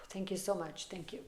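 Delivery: almost inaudible, moved thanks; soft grateful murmur. Real, not acted. Reader thanking Hanuman, trailing off to a murmur. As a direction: sigh then very soft thanks.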